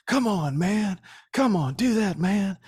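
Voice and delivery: lightly voiced Biden impression; a hint of Biden's "come on, man"; Joe Biden. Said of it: "people are like, "Just, just go".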